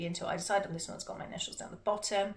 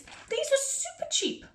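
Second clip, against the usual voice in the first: squealed delight; slower than usual. Acted. Gushing over cheap gel pens.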